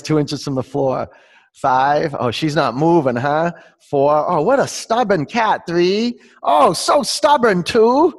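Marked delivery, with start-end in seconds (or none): 1.5-8.1 high, loud playful countdown, building higher; playful teasing. Real, not acted. Yoga teacher teases a stubborn cat mid-countdown, playful.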